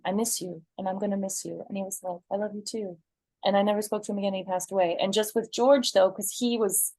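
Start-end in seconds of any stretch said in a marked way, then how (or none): 0.1-3.0 quiet and close; soft tender. Retold or imitated. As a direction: say it gently, almost under breath.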